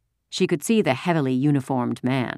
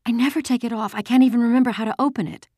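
put-on high, rushed panicky girl; frantic girl speeding up as she goes; 10 semitones higher, 3 dB louder, faster than usual. A narrator's reading. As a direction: race through it in a panic. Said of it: "Ellen said frantically".

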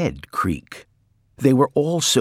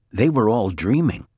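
ordinary delivery

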